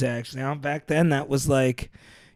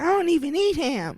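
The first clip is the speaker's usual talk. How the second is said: squeaky high voice; high and thin, half laughing; high falsetto; 5 dB quieter than usual. Retold or imitated. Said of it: "I'd be like".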